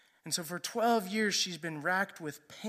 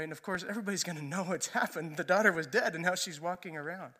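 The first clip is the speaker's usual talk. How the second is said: ordinary talk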